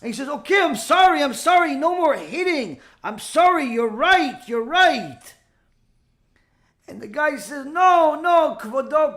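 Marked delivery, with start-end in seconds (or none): 0.3-5.3 raised, louder acted apology; urgent apology. Rabbi voiced apologizing to attacker. 7.7-9.2 loud, high acted pleading; urgent protest. Guilty man begs rabbi in story.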